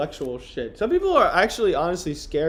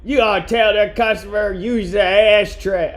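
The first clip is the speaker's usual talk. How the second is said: put-on loud, higher, clumsy Boston accent; Boston accent attempt; 6 semitones higher, 8 dB louder than usual. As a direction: overdo a shaky grasp of Boston accent. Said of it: "I'll do a Boston accent".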